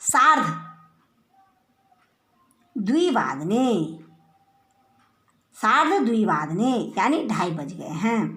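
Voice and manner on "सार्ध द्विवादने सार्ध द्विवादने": slow dictation. Shown as a demonstration. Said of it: "जैसे सार्ध".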